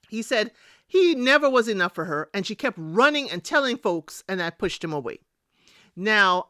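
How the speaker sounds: ordinary talk, nothing marked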